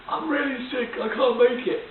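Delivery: high, feeble put-on sick voice; playing sick to get out of the trip; fake sick voice. Faking illness to a friend, high and feeble.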